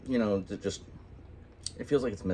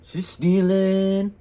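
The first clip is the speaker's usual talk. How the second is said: mock gossip; 5 semitones higher, 3 dB louder, slower than usual. Mock gossip about wife eating.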